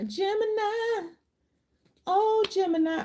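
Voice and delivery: high, drawn-out call of Gemini; sing-song call. Real, not acted. Tarot reader calling Gemini viewers into the reading, high sing-song call. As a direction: croon the sign's name up high, softly.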